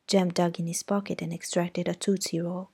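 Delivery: ordinary delivery, nothing marked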